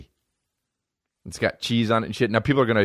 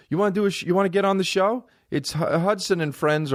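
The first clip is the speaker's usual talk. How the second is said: higher-pitched imitation of a pushy booker; eager festival guy; 10 semitones higher than usual. Guy with the laminate offering a spot, imitated eager pitch. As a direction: pitch the show in a raised voice.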